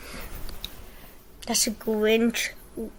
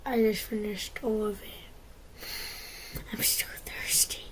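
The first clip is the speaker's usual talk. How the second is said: breathy whisper, barely voiced; hushed whisper to the camera; 2 semitones lower, 9 dB quieter than usual. Real, not acted. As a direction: whisper it so nobody wakes.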